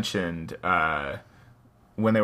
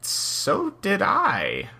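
slow, high character voice; 9 semitones higher, much slower than usual. Acted. Character line, then narration.

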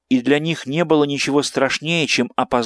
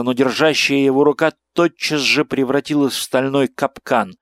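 ordinary delivery

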